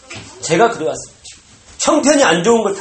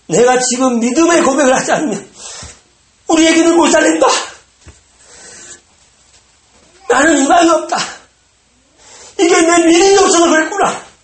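desperate realization; 10 semitones higher, 7 dB louder than usual. Acted. Father's desperate inner cry.

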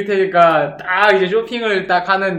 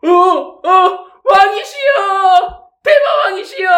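high, slow wailing cry to a king; terrified shout; 12 semitones higher, 5 dB louder, much slower than usual. Acted. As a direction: cry out in loud, high-pitched awe. Customer cries out to the dark lord. (높고 느리게 외치는 비명)

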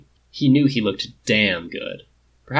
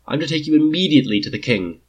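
quick, louder, clipped soldier's order; brisk captain of the guard; 6 semitones higher, 5 dB louder, faster than usual. Acted. Captain tells the prince to come at once, quick and firm. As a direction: hurry him along, no nonsense.

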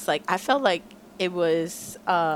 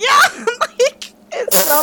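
excited squeal; 8 dB louder, much slower than usual. Real, not acted. Fangirling over lyric.

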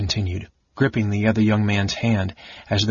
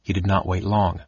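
plain reading voice

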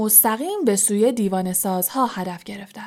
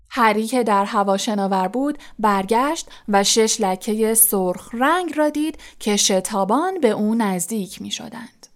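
plain reading voice

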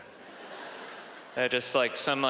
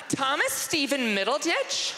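put-on high, girly questioning greeting; put-on female surprise; surprised girl; 11 semitones higher than usual. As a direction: exclaim the name as a question. Girls greet him in imagined dorm.

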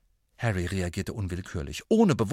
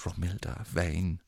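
softly, the name almost dreamed; 4 semitones lower, 7 dB quieter than usual. A narrator's reading. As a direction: breathe the name out gently, lovestruck. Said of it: "sagte Ron leise".

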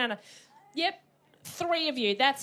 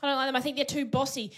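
normal talking voice

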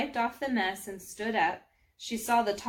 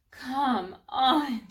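exasperated, loud, higher-pitched groan of words; 4 semitones higher, 5 dB louder than usual. Acted. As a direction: moan it in frustration after a fall. Exasperated thought after falling in mud.

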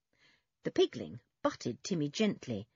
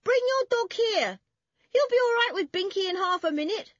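very high, loud calling; shout; 7 dB louder than usual. A narrator's reading. Toby tells the girls to come over, shouting. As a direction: holler instructions across a distance. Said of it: "shouted Toby to the girls".

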